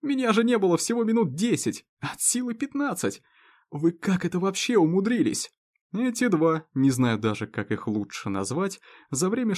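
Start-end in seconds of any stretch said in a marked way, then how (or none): 0.0-5.5 incredulous. Acted. Shocked at drunk friends.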